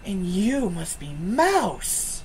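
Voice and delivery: slow, drawn-out greeting; slow mystic line. Acted. Game master as Madame Eva naming Mouse: slow and drawn out. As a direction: linger on every word, very slowly. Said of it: "She turns and she goes".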